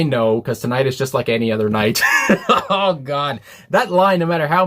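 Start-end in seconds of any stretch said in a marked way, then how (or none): 2.1-2.7 short breathy burst of laughter. Real, not acted. Reacting to a painful song lyric, laughing. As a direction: let out a brief, breathy laugh.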